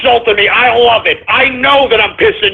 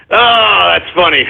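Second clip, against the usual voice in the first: ordinary delivery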